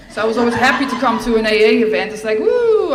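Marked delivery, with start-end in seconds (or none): 2.4-2.9 high, loud whoop of delight; gleeful high loud woo; excited whoop. Retold or imitated. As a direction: cheer like a freed teen. Joy at smoking with everyone.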